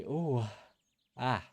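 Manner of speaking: a yawn on the word. Real, not acted. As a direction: yawn through it. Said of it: "sorry for that yawn".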